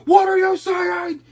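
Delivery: very loud, high, drawn-out yell; screaming angry dad. Retold or imitated. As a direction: bellow at your kid for cussing. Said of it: "started screaming at the kid for cussing".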